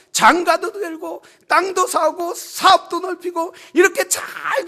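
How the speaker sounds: acted incredulous protest, swelling loud then dropping off; scoffing townspeople. Townspeople dismissing Noah's talk of judgment, scoffing. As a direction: scoff at the prophet, pushing the complaint high. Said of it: "사람들은 뭐라고 했을 거예요?".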